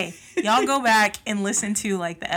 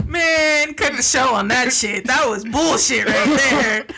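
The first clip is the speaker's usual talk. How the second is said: loud, higher, laughing protest; mock outrage; 4 semitones higher, 9 dB louder than usual. Real, not acted. Co-host reacting to a terrible TV take, loud laughing outrage. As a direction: yell it down through laughter.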